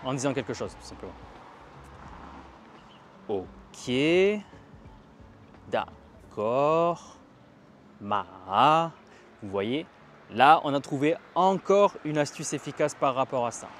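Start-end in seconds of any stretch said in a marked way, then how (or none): 3.3-7.2 demonstrated hesitation. Shown as a demonstration. Teacher showing hesitation as a trick for the third tone, hesitant. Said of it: "si vous aviez une hésitation". 8.0-8.9 MA stretched, dipping low then swelling upward; hesitant dipping tone. Shown as a demonstration. Teacher turning French hesitation into the third tone, hesitant. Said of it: "ça va être l'hésitation".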